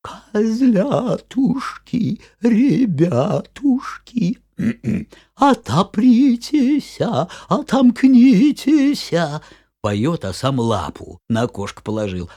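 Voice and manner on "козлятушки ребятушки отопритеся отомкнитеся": high, slow thin fake voice; wolf squeezing out a thin voice; wolf faking sweetness. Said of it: "тоненьким голоском".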